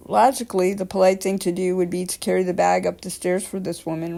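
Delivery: ordinary delivery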